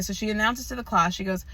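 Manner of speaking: ordinary delivery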